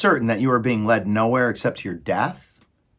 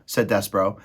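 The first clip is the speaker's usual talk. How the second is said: ordinary delivery, nothing marked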